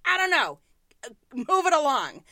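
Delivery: brusque dismissal. Retold or imitated. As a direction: wave the kid away impatiently. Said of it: "I'm like".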